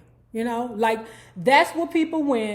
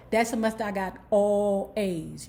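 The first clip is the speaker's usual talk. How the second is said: usual speaking voice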